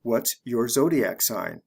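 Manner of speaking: slow, careful "What's your zodiac sign?" with "your" kept separate; deliberately slow teacher model. Shown as a demonstration. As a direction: spell it out slowly, word by word. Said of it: "I'll say it the slow way".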